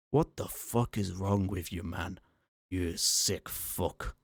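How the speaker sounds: a lightly voiced angry Scotsman; a slow, disgusted Scottish brogue; male Scottish accent. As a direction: growl it out in a Scottish accent, slowing on the last words. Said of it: "now speaking in a male Scottish accent".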